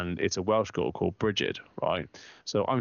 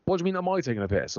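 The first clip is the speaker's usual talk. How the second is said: high, fast mock indignation; hammed-up offended self; mock indignant retort; 9 semitones higher, much faster than usual. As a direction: fire it back, offended and quick. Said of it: "And I was like".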